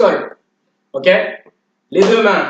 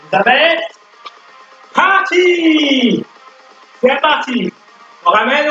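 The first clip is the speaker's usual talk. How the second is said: barking gym coach; 5 semitones higher than usual. Acted. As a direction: pump the class up like a fitness coach. Leads workout over music.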